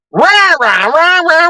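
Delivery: high, far-off parody shriek of "rah, rah"; mock screeching rah. Mocking the angry mother's screaming at him. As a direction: imitate her screaming as gibberish. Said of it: "screaming at me".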